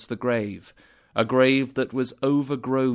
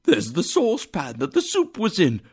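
excited cry; 6 semitones higher, faster than usual. A narrator's reading. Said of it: "cried Scrooge".

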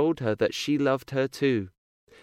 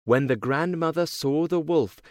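ordinary delivery

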